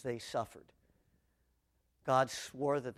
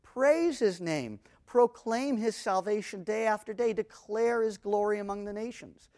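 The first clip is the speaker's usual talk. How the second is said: psalm declamation; 6 semitones higher, 5 dB louder than usual. Acted.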